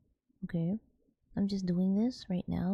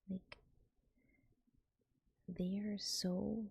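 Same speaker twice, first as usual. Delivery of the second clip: soft breathy ASMR whispering; 4 dB quieter than usual. Acted. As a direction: whisper gently into the mic.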